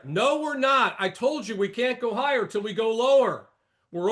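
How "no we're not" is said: normal talking voice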